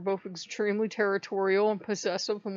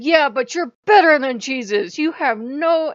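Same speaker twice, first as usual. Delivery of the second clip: loud, raised mock tirade; retold yelling; 5 semitones higher, 10 dB louder than usual. Speaker yelling at Odin as he just looks, acted angry rant. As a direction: rant at him, hammering the words. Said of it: "and I'm yelling at him".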